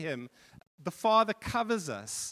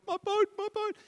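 put-on distraught boy. A narrator's reading. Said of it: "the boy was distraught".

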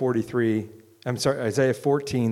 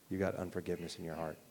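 much softer, quick inner voice; quiet voice of God; 6 semitones lower, 11 dB quieter, faster than usual. Retold or imitated. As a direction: say it softly like a word heard inside. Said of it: "And the Lord showed me".

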